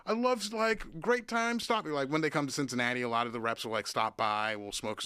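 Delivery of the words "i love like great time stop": high, hurried imitation of a rep; gushing salesman voice pitched way up; mock drum company rep. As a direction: rep babbling pleasantries. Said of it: "would be like".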